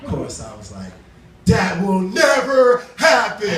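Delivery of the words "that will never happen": loud, slow, emphatic shout; shouted defiantly, each word drawn out; loud slow mock-shout dismissing the warning; defiant retort. Confident retort in told argument.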